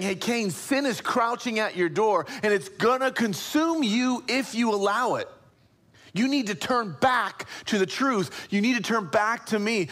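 urgent warning. Acted. God warning Cain of sin, urgent plea.

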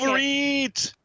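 high, loud shouted war cry; drawn-out, full-voiced holler; battle cry. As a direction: yell it like a movie rallying cry. Calls out Red Dawn battle cry.

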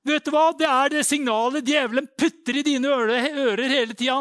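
high, loud preacher's warning; full-force preaching. Real, not acted. Preacher warns listeners about the devil's signal, shouting high. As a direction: shout it out to the congregation.

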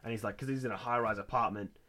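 usual speaking voice

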